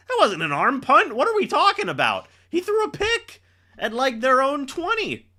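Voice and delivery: high, fast, disbelieving protest; incredulous outburst. Real, not acted. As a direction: argue it wasn't an arm punt. Outraged defence of the play.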